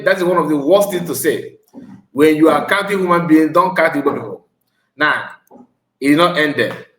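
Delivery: normal talking voice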